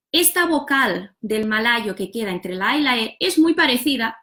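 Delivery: ordinary talk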